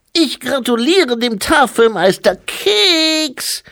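high, loud infant voice, slowed; drawn-out piping toddler pronouncement; baby-like abbot. A narrator's reading. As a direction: congratulate grandly, squealing the last word like a baby.

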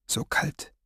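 breathy whisper of cold. Acted. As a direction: whisper it, chilled to the bone. Repeated with stronger chill.

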